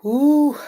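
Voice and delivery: a loud, high whew of release. Real, not acted. Streamer reacting to Spirit's message, loud high whew. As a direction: blow out a big whew as the message lands.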